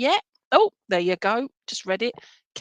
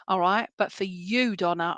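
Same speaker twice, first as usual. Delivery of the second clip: normal talking voice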